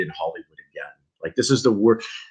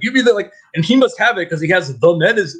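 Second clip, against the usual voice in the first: much higher, odd mock voice; mocking the character; 6 semitones higher than usual. Mocking the Kevin Bacon character's line in a high silly voice. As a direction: squeak the line out like a dumb script. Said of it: "it's just like".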